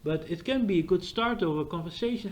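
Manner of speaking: ordinary talk, nothing marked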